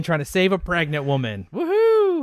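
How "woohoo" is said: high, long woohoo; gleeful whoop. Pretend. Host cheers his imagined heroic death, gleeful whoop. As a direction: whoop like a hero charging in.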